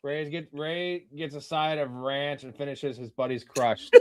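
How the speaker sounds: slow, soft reading broken by laughter; laughing. Real, not acted. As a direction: giggle while reading it out. Reading comment while laughing.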